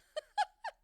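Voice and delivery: laughing up high; real laugh at his own story; high squeal of laughter. Real, not acted. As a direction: break into laughter. Laughing after the sparrow punchline.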